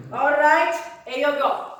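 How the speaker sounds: ordinary talk, nothing marked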